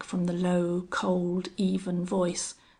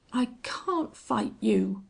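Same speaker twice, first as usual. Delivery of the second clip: slow, high, panted refusal; strained, slow plea; panting; 5 semitones higher than usual. A narrator's reading. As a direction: pant it out helplessly. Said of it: "panted Belllounds".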